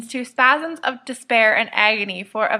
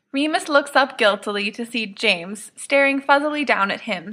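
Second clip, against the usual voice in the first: ordinary delivery, nothing marked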